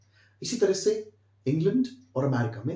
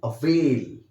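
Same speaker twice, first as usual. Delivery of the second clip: Avail said louder and stretched; stressed avail; 5 dB louder, much slower than usual. Shown as a demonstration. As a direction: lean hard on avail, drawing it out. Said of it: "थोड़ा सा stress बढ़ाइए". (Avail पर ज़ोर देकर खींचकर)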